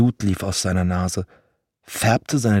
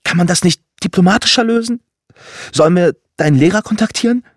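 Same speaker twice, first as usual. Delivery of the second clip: much higher, louder and fast, anxious mother; shrill and panicked; 11 semitones higher, 7 dB louder, faster than usual. Acted. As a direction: rush out the worried questions in alarm. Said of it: "Ihre Stimme schrill".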